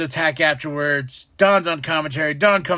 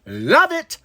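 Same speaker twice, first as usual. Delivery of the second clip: excited high; 10 semitones higher than usual. Real, not acted. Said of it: "excited about reference".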